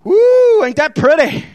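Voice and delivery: lightly voiced lure, low and sly; voice of hell. Hell tempting the listener, lightly voiced coaxing. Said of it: "Hell's gonna make sure he lays out the mat".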